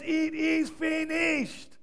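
high, slow, triumphant shout; victory shout. Acted. Preacher acting Jesus on the cross, triumphant shout. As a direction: ring it out like a winner. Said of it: "It's a victory shout".

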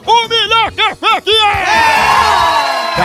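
high, slow, stretched shout; slogan shouted. Acted. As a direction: belt out the jingle line. Closing slogan of the advert. (slogan gritado e arrastado)

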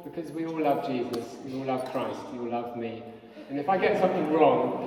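ordinary talk, nothing marked

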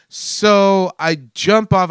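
normal talking voice

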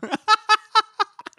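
loud squealing laugh; high cackling laugh. Real, not acted. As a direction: burst out in a shrill cackle. Laughs after calling her dumb.

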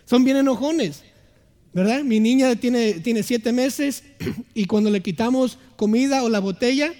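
ordinary talk, nothing marked

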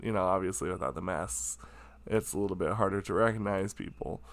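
reassuring. Retold or imitated. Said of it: "he was just like".

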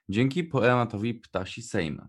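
ordinary talk, nothing marked

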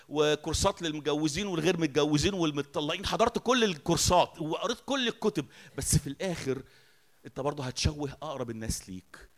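ordinary talk

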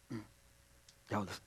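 usual speaking voice

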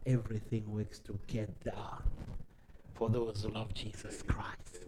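whispered softly into the phone; hushed whisper. Retold or imitated. Answering his pastor by phone, a soft whisper. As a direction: breathe the verse like a secret. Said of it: "And I said, "No, ma'am".

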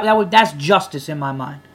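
usual speaking voice

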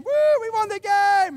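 mock triumphant crowd cheer; imagined victory cheer. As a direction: savor the win in your head. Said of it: "plays good in your mind".

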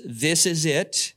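slow, settled doctor's verdict. Retold or imitated. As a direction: say it like the final word. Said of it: "the doctor says".

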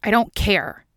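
angry retort. A narrator's reading. Mulder storming out on Scully in boxers, angry. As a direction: throw it off angrily.

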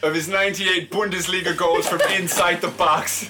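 slow, high-pitched mock Irish accent. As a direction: read it out in a loud, lilting Irish accent, on the edge of laughing. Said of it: "I'll read it in an Irish accent".

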